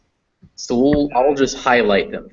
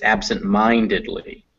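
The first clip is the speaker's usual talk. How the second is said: "absent-mindedly" with the main stress on MIND; absent-MINDedly, teacher modelling the stress of "absent-mindedly"; 3 semitones lower than usual. Shown as a demonstration. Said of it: "is the stressed one".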